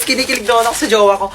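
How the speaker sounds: normal talking voice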